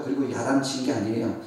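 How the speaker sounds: normal talking voice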